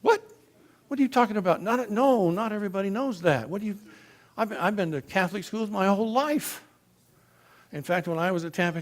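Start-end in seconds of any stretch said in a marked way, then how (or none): 0.0-6.6 higher and louder, retelling his own shocked protest; stunned disbelief. Retold or imitated. To the priest, a retold higher, louder stunned protest. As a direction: protest as if the ground just moved.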